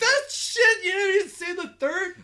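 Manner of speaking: high, loud disbelief; incredulous outburst. Real, not acted. Challenging a friend who skipped episode three, loud incredulous outburst. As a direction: burst out at your friend, pitch shooting up.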